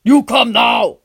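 barked like a creepy film villain; horror film voice. A narrator's reading. Guard barking at the boy, horror film villain voice. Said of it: "His voice sounds like he's one of those Muggle horror films".

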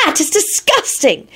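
high, appalled exclamation; revolted. A narrator's reading. Ginny disgusted at Kingsley, revolted. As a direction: recoil in a high voice. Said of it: "screeched with a revolted expression".

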